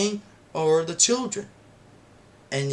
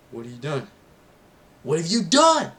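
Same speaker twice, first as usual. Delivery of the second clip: rising outcry; 3 semitones higher, 5 dB louder, faster than usual. Retold or imitated. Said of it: "Harry said".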